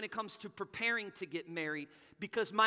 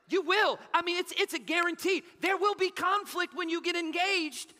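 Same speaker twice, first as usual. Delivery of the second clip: much higher, louder full-throated preaching; preaching at full force; 9 semitones higher, 7 dB louder than usual. Acted. Pastor warns engaged couples of conflict, preaching hard. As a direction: build the point up and hammer it home.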